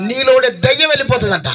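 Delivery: high, impassioned pulpit shout; full-force preaching. Acted. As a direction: drive it up to a hallelujah cry.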